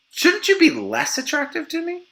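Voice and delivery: high-pitched imitation of a woman; wife's questioning voice. Wife to husband after birth, high questioning.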